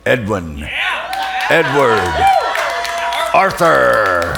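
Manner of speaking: drawn-out announcer call building to a loud shout; ring announcer. Pretend. Introducing his dearest friend, mock ring announcer building to a shout. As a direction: boom it out like a boxing ring announcer.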